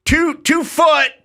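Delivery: high, slow, shouted repeat; shouted measurement. Retold or imitated. Impatient boss shouting the measurement again across the lot, high and slow. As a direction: bellow it again, drawn out. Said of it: "and he'd go".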